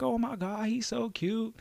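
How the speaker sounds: put-on high, soft cooing girl voice; gushing girls. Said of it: "They be like".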